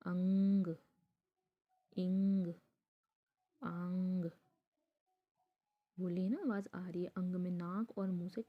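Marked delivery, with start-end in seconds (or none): 0.1-4.3 अंग इंग आंग said loud, ringing through the nose; अंग, इंग, आंग said loudly with a strong nasal ring; nasal vowel demo. Shown as a demonstration. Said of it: "नाक से भी टकरा रही है आपकी आवाज़".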